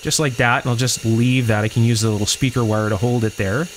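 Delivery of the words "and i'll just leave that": usual speaking voice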